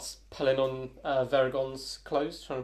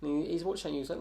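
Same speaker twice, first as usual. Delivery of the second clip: ordinary talk, nothing marked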